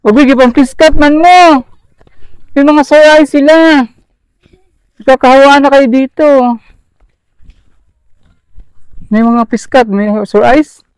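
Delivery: high, loud, urgent warning; excited. Real, not acted.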